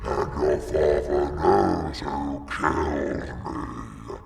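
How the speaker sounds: low, slow monster voice; a ghoul revealing a dark secret; skeleton voice. A narrator's reading. The skeleton accusing Helen's father, low and slow.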